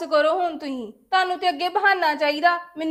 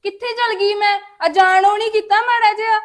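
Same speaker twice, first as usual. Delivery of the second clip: louder, rising indignant cry; exasperated protest; 4 semitones higher, 7 dB louder than usual. Acted. Daughter-in-law protests mother-in-law's accusation, loud and indignant. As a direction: throw up your hands, loud and wounded.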